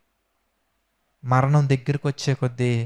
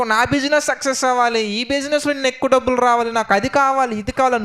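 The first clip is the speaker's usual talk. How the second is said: higher, whiny imitation of a money-hungry prayer; mock selfish prayer; 7 semitones higher than usual. Preacher imitates a believer praying for money, higher mock whine. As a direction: mimic a believer begging for business success, pitched up. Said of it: "నువ్వేమో డబ్బు సంపాదన కొరకు".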